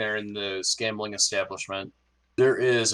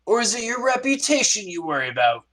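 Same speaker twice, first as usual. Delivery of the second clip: much higher, searching first mate voice; probing first mate; 12 semitones higher than usual. Acted. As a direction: needle him about money or reputation. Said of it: "He stops and he turns to you and goes".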